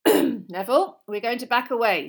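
projected teacher's instruction; teacher calling out. A narrator's reading. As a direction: address the class firmly and loudly. Teacher calls to the class.

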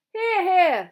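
high, hearty cheer; loud. A narrator's reading. Two boys applaud the start of the feast, loud. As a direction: cheer it out loudly. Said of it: "said Harry and Ron loudly".

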